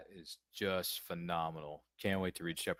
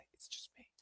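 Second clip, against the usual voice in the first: breathy whisper to nobody; whispered aside; 18 dB quieter than usual. Real, not acted. Host joking that he is alone in the studio, whispered. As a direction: drop to a secret whisper.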